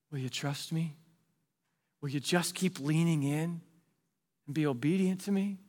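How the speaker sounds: soft, hushed plea; God's quiet call. Acted. God softly calling the listener down the path. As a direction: ask gently, almost under the breath. Pastor voices God to the jobless.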